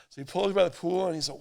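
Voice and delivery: ordinary delivery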